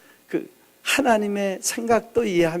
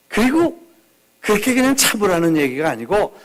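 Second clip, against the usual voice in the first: ordinary delivery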